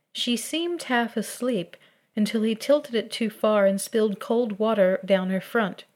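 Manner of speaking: plain reading voice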